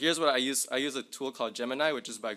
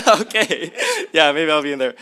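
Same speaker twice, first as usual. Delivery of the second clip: laughing through the words, much louder; 4 semitones higher, 12 dB louder than usual. Real, not acted. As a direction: chuckle it out at the video. Laughs at the deepfake clip.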